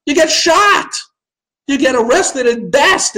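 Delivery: indignant high exclaim. Real, not acted. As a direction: punch it out like a punchline. Ranting about Cuba.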